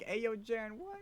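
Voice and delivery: imitating Albert. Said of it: "There's an Albert listening".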